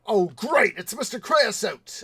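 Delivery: loud, high mock dismay; hammed-up group outcry; alarmed fish in a skit. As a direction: cry out as if spotting trouble. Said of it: "they go".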